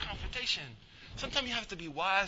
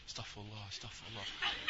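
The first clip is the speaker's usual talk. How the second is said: low, soft muttered prayer; imitating a pious murmur; hushed repenting; 8 semitones lower, 11 dB quieter than usual. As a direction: mutter it under your breath over your beads. Brother murmurs repentance while buying porn.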